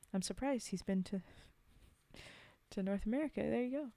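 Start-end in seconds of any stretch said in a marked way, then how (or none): none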